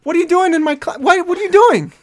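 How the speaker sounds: very high-pitched, put-on outrage; hammed-up high indignant professor; mock scolding. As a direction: squawk it at a student in pretend disbelief. Professor scolds student in role-play.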